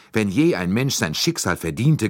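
a mild, understated grudge; grumbling resentment. A narrator's reading. Son of the prosecutor condemning the kidnapper, mildly resentful. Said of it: "grollte er".

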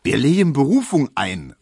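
loud, high, resolute cry; called out. A narrator's reading. Barber taking over colleague's case, calling out. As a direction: announce it loudly to the shop. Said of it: "rief Tesch wieder".

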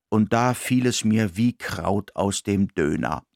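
usual speaking voice

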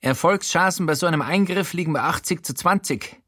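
lightly voiced ailing politician; weary politician confessing. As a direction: confess your sickness, half bitter. Showalter continues confession.

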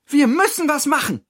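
high, urgent character voice; indignant Hira. Acted. Hira demands that the friends act, high and indignant. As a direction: urge them on in high indignation. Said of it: "Auch sie ist ganz empört".

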